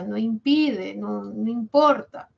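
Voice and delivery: ordinary talk, nothing marked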